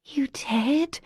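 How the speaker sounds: awed whisper; hushed, amazed whisper; breathed. Acted. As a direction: breathe it out in wonder. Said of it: "she breathed".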